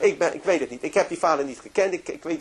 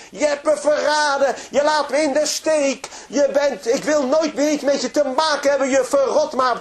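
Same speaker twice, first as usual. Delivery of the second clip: a father's high, loud shout of betrayal; furious retold shout, high and loud; 11 semitones higher, 7 dB louder than usual. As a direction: yell it at her in front of everyone. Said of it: "begint te schreeuwen".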